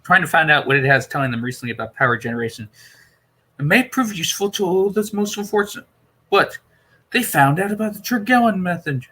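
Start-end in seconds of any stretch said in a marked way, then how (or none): 3.6-9.1 higher, grave Doctor voice; a lightly voiced Doctor breaking bad news. Doctor warning Perry about the Romans, grave.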